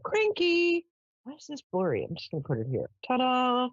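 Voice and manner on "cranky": high mock cranky. Real, not acted. Reading the weather card.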